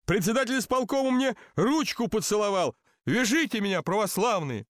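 high-pitched, pleading outcry; crying out. A narrator's reading. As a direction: wail it to the crowd, mildly acted. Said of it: "закричал он, бухаясь на колени".

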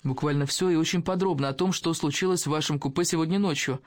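plain reading voice

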